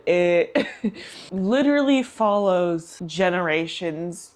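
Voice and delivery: ordinary talk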